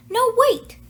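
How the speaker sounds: sudden high, loud call to stop her; urgent call. Acted. Nancy stopping Rhonda from leaving, urgent shout. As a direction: call her back before she walks out.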